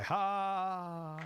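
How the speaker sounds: high, long-held "haaa"; strained attempt at a high-pitched laugh; Eight-bit Eric laugh. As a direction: stretch one laugh syllable out high. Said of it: "Eight-bit Eric impression. I can't get my voice to go that high".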